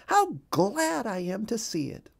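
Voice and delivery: high, delighted mother duck; glad and excited. A narrator's reading. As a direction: beam with relief. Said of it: "quacked Missus Mallard excitingly".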